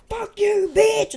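retold yell, loud and high; mock outburst. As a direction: blurt it out like a sudden lost temper. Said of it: "I'll go and tell my".

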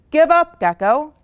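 loud, high taunting shout; rising, punched-out call. A narrator's reading. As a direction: shout it like a jeering crowd. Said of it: "All of the animals shouted".

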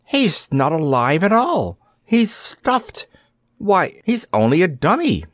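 a hint of a surprised girl, high; girl realizing it is a dummy; lightly voiced little girl. A narrator's reading. Dorothy voiced as a child.

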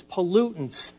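ordinary delivery

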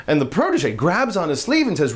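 ordinary delivery

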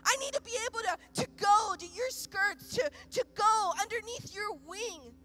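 loud, high, impassioned prayer; rising passionate preacher's cry; full-force preaching. Acted. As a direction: pour it out at full force to God. Preacher cries out to God.

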